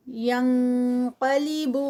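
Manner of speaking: ینقلب slow, the ن hidden into a nasal before the heavy ق; heavy ikhfa. Shown as a demonstration. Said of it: "آگے اخفا ہے". (ینقلب میں نون کا بھاری اخفا)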